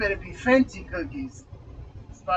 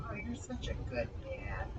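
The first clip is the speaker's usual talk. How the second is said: soft, low, condescending sweetness; a hushed mock compliment, much lower and softer; patronizing mock praise; 8 semitones lower, 14 dB quieter than usual. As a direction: talk down to him like a pet. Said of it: "what they say to patronize you".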